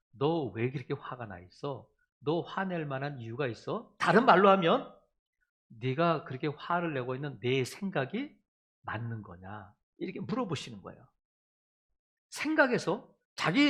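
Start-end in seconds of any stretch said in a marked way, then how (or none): 0.1-3.9 gentle questioning. Acted. God gently asking the angry Jonah, softly. God's question voiced to listener. 5.7-9.7 gentle questioning. Acted. God gently asking the angry Jonah, softly.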